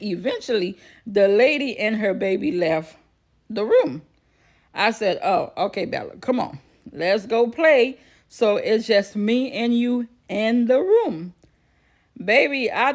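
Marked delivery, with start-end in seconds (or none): none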